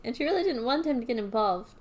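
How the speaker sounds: ordinary delivery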